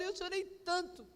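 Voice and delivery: ordinary delivery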